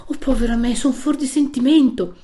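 high, loud, bewildered cry; voicing a distraught woman who suddenly recognises someone; astonished exclamation. A narrator's reading. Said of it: "Lo fissò di nuovo ed esclamò".

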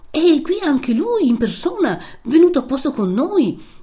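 high, swooping, excited announcement; voicing a flustered priest, pitch leaping; emphatic assurance. A narrator's reading. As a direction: stress eagerly that he came in person. Priest hurriedly reassures and warns.

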